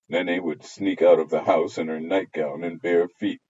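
usual speaking voice

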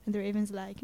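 ordinary talk, nothing marked

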